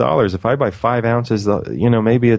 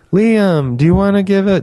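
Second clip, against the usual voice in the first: high, bright-eyed friend voice, a bit louder; imitating Nicole's voice; 10 semitones higher, 4 dB louder than usual. Imitating his friend Nicole inviting him to give a talk, high and eager. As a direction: mimic how she talks, pitched way up. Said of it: "that's how she talks".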